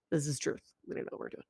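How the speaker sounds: unvoiced confiding whisper; whispered aside. Real, not acted. Teacher confiding to students that teachers are lost, whispered. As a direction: let it slip under your breath.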